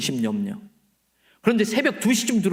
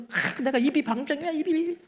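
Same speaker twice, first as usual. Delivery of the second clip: high, lilting mock self-reproach; self-scolding old lady; 9 semitones higher than usual. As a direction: slap your own wrist, pitched well up. Deaconess scolds her own loose mouth.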